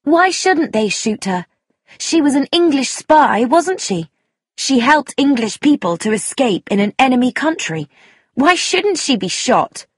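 high, challenging questions; demanding. A narrator's reading. Young woman challenging the table about the spy, demanding. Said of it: "It was then that Sheila demanded".

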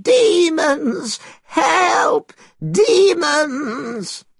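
put-on very high, wailing old man's scream; terrified cry. A narrator's reading. Old man fallen before the strange followers, terrified cry. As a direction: cry out in terror for help. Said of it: "The old man fell on the floor, crying".

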